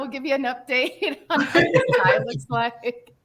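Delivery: slow, laughing announcer tease; laughing mock update. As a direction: promise the update through laughter. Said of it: "mock update announcement".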